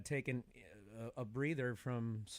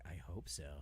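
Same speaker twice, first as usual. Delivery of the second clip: deadpan wish; 8 semitones lower, 8 dB quieter, slower than usual. Retold or imitated. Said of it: "I was like".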